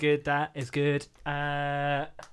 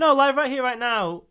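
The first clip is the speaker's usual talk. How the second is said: louder and higher, slow and deliberate; emphatic; 10 semitones higher, 10 dB louder, slower than usual. Real, not acted. Defending the album to his friend, loud and emphatic. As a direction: insist on the album title.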